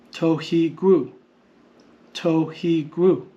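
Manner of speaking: slow "tohigru", each syllable apart. Shown as a demonstration. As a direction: sound out "tohigru" as to, hi, gru. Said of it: "And the syllabary shows us".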